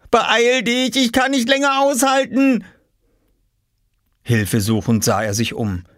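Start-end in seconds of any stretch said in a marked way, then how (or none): 0.1-2.6 put-on high, desperate boyish wail; urgent call. A narrator's reading. Oskar needing the toilet, calling urgently to his mother. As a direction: hurry them along, about to burst. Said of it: "rief er".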